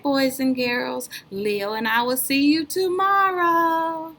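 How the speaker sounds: ordinary delivery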